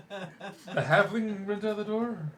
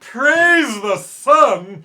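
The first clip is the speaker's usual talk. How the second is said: very slow booming proclamation; booming shout; 6 semitones higher, 16 dB louder, much slower than usual. Pretend. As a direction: stretch out each word in a bellow. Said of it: "Told you I wouldn't run out of voices".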